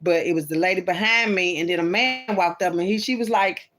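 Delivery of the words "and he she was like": normal talking voice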